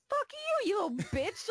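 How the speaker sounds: high-pitched mock indignant yell; retold angry outburst. Gamer cursing at the game's guide, angry. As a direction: cuss out the game in comic outrage.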